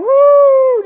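high, loud cheering whoop; loud excited crowd cheer; studio audience whoop. Retold or imitated. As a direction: whoop like a game show crowd. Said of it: "Just like Price is Right".

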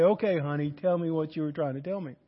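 low, soft voice to his wife; gently attentive. Retold or imitated.

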